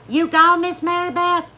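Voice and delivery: calling out. Retold or imitated. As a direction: hail her down the aisle. Walmart folks calling to her.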